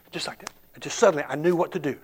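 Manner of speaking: ordinary talk, nothing marked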